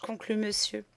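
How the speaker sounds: ordinary delivery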